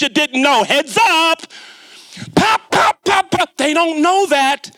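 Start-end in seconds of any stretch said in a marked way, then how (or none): 2.3-3.6 mock key pounding. Shown as a demonstration. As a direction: punch each pop like a hard keystroke. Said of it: "hit those keys kinda hard".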